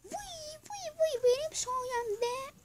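very high excited squeal. Real, not acted. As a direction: squeal with glee, drawn out. Excited squeal at teammate's kill. (heyecanlı ciyaklama)

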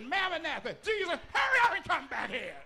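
high, loud, near-screamed cry to Jesus; shouted pleading prayer. Acted. Preacher voicing Paul begging Jesus to come back, in a high, loud shouted plea. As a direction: beg Jesus to hurry back, at full cry.

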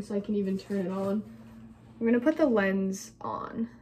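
ordinary talk, nothing marked

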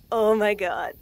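ordinary delivery, nothing marked

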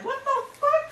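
high, hushed squeak of disbelief; soft mock-shocked squeal. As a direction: react appalled in a small, very high voice. Retold shock at being flirted with.

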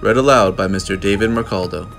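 usual speaking voice

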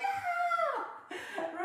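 very high, drawn-out kid's scream, played for laughs; imitated screaming. Mother imitating her other son held down for shots, screaming. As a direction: shriek like a child refusing a shot. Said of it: "kicking and screaming".

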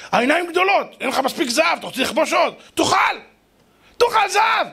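high, rising tirade at a guest; indignant scolding. Acted. Women scold the greedy king at their table, indignant. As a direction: build up to a high-pitched taunting command.

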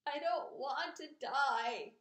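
slow, loud, high tearful wail; moaned. A narrator's reading. Pig bursting into tears before his friends, moaned. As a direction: moan it out, stretching the words. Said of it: "he moaned".

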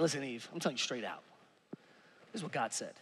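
hushed fast Adam voice; Adam laying down the law. Retold or imitated. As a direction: mutter it as a bossy husband making up rules. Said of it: "He was like".

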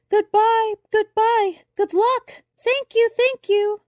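high, loud goodbye shouts; shouted farewells. A narrator's reading. Crowd on the beach shouting goodbye to the departing ship, loud and high. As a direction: call it out loud to a ship leaving. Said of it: "At last everybody shouted".

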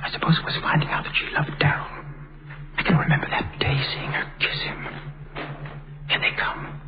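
hushed, breathy private musing under the scene; whispered inner thoughts. Acted. Gordon privately musing about his mother, in a hushed whisper. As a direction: let the thoughts slip out in a whisper, as if no one can hear.

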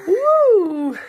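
loud, high whoop; excited woo. Real, not acted. Dad whooping to get a reaction from his baby.

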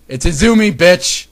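high, loud gloating; triumphant shout. Real, not acted. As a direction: crow the correction at full volume. Reader gloats over spotting the error.

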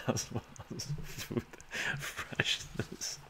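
words breaking into breathy laughter; laughing. Real, not acted. Streamer joking about preservatives in fries, laughing. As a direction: crack up mid-sentence. Laughs at own fry joke.

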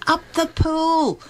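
high, drawn-out mock crowd yell; imitated shout. Excited young fan calling to the Beatles on stage, imitated high shout. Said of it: "and I shouted out".

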